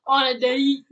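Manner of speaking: loud mock southern drawl; southern accent. Echoing a friend's southern slip, mock southern accent. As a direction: tease with an imitated southern accent. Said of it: "Why am I getting so southern?".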